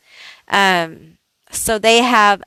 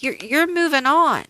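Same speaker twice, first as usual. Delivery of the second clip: normal talking voice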